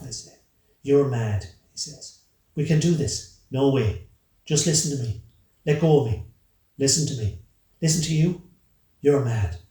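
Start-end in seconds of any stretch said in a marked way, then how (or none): 0.8-1.4 loud, exasperated accusation; heated outburst in an argument; angry accusation. A narrator's reading. Acted quarrel, accusation. 2.5-9.6 loud, raised-pitch argument; urgent, agitated shouting match; heated quarrel. A narrator's reading. Acted struggle and shouted quarrel.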